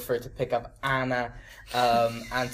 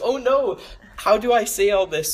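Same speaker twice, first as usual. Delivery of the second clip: mock panic; 10 semitones higher, 7 dB louder than usual. Panicking at a tiny Twitter box.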